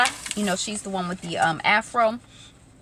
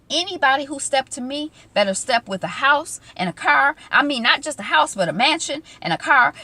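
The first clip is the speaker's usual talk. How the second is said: higher-pitched sassy listing; mock boastful demands; 6 semitones higher than usual. [name] listing what suitors must bring, high mock sass. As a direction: pile up demands in an imitated diva voice.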